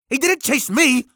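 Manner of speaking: put-on high, loud young boy protesting; boy protesting, high-pitched and loud. A narrator's reading. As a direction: insist it wasn't so, high and loud. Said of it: "Barnaby protested".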